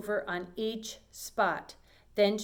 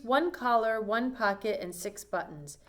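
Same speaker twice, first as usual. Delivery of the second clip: plain reading voice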